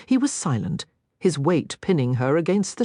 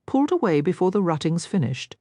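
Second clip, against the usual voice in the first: ordinary delivery, nothing marked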